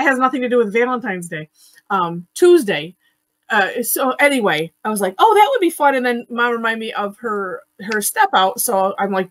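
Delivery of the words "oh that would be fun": higher, louder burst of enthusiasm; light up at a fun idea, voice jumping up; excited reaction. Retold or imitated. Host recounting her reaction to a fun idea, excited. Quotes own pleased reaction.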